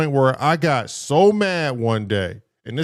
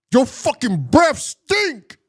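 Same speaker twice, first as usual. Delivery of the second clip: high, slow, drawn-out complaint; exasperated outburst; 13 semitones higher, 3 dB louder, slower than usual. Retold or imitated. Kid finally telling the teacher off, high and slow. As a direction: blurt it out high and stretched, fed up. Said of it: "I said".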